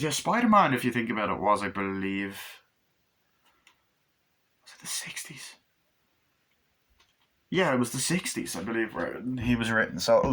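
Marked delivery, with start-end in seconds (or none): 4.6-5.5 whispered aside to himself. Real, not acted. Vlogger whispering to himself while trying to remember. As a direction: breathe it as a private question.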